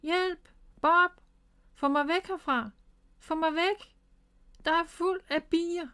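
high-pitched, panicky calls; alarmed cry for help. A narrator's reading. Miffy calls Bob away from the bees, alarmed. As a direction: plead in alarm to be pulled away.

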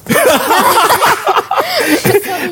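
bright burst of laughter, high. Real, not acted. Laughs at the reply.

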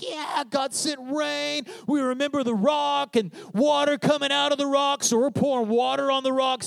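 high, elated cheer of a festival crowd; celebrating crowd. Acted. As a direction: cry it out like people partying in praise.

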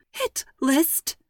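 high squeak of alarm; alarmed mother squeaking the words back. A narrator's reading. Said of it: "Mom squeaked".

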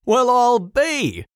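high, slow and delighted; greeting an old friend at the door, with a high, slow, happy shout; joyful shout. A narrator's reading. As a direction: call out in glad surprise at the door. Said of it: "shouts the distiller".

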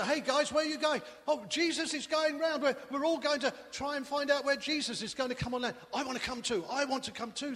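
put-on high, loud boyish shout. Little boy shouting to schoolmates from his window, high eager shout. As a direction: call out the window to mates.

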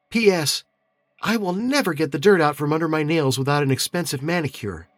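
high, light female voice; young woman voice. A narrator's reading. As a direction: voice Caitlin's note, young and bright. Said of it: "reported in Caitlin's handwriting".

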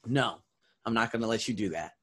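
normal talking voice

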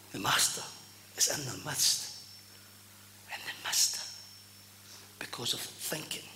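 soft, low, half-whispered pulpit delivery; hushed preaching. Acted. Preacher grieving those who missed the Master, hushed preaching. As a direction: drop low and breathe it out, with long pauses.

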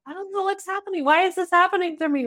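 put-on sweet innocent girl. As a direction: play the sweet naive kid who can't understand it. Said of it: "the really sweet one who was like".

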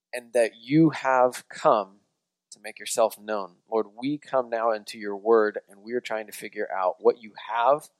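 hushed, reverent address to God; prayer. Real, not acted. Leads a prayer.

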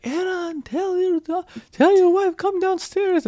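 very high-pitched imitation of her accent; mother-in-law impression. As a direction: mimic mom calling out, drawn-out and high. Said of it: "you try to imitate my mom's accent".

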